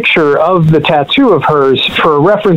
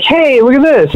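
higher-pitched, eager show-and-tell; retold excitement, pitched up to get attention; excited retelling; 9 semitones higher than usual. Showing his friend the new tattoo, excited. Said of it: "excited to show her".

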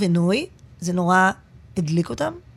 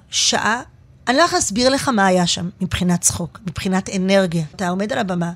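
ordinary delivery

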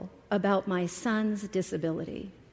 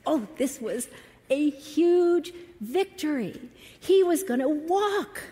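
excited; 6 semitones higher than usual. Real, not acted. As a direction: celebrate a hard-won victory. Joy at son's first steps.

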